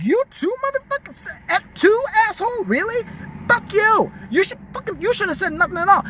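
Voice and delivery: high-pitched, incredulous indignation; outraged confrontation. Pretend. Comic betrayed by a best friend tells them off, outraged and high-pitched. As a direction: act out telling off a traitor, voice shooting up high.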